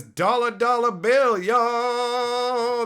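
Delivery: high, loud rap hype voice; rapper voice. Acted. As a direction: belt it like a hype man. Reading chat quote in put-on voice.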